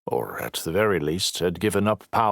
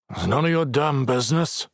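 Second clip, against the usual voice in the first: growling; 7 semitones higher than usual. A narrator's reading. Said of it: "Logan growled".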